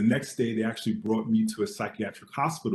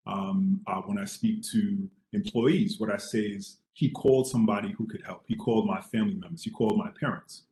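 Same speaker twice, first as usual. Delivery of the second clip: ordinary delivery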